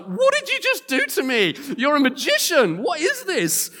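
high, loud, incredulous yelling; imitating a flabbergasted lad; mock stunned outburst. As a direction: act out a teenager freaking out in disbelief. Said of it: "his jaw drops open. He starts to swear his head off".

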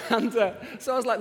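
laughing. Real, not acted. Laughing after the calves joke.

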